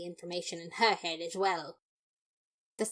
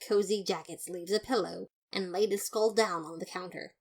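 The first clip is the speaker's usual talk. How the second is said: usual speaking voice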